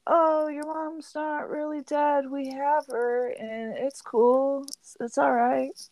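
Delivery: mock reassuring gods. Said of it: "they're like".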